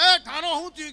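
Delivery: high, loud cry to put out the fire; alarmed shout. Retold or imitated. Mourner shouting at the man whose cloth caught fire, alarmed shout. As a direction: yell it out in a panic.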